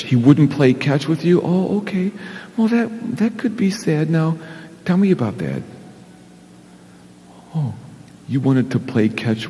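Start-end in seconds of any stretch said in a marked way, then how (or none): 0.0-5.6 put-on higher, sing-song voice for a child; puppet chat. Pastor coaxing the puppet Big Al, higher and sing-song. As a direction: coax a sulky little kid to talk.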